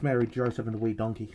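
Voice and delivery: normal talking voice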